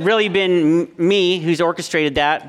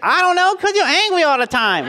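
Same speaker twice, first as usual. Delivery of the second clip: little son's voice; 12 semitones higher than usual. Retold or imitated. Dad retelling his son warning his sister in a high child voice. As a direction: play the small boy warning his sister. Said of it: "he's like".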